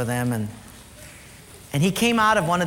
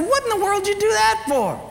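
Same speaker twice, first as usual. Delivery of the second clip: scornful dad; 3 dB louder than usual. Retold or imitated. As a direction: sneer at the gift like an annoyed father. Father scorning son's gift.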